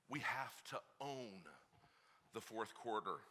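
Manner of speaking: low, hushed and slow; quiet first take. Shown as a demonstration. Preacher testing the dads, quiet and slow. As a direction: say it softly, almost to yourself. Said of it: "Did you hear me".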